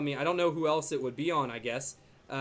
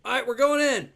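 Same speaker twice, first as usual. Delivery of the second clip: louder and higher, charging in; pumped up; 8 semitones higher, 9 dB louder than usual. Real, not acted. Streamer hyping the launch of his live attack, pumped up. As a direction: announce the attack with a burst of energy.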